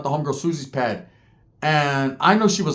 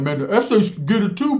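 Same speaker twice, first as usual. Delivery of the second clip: mock Blood taunt; 5 semitones higher than usual. Bloods taunting the SA's, first time, mildly raised call-out. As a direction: imitate a rival calling out, a bit higher. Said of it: "what the Bloods were saying".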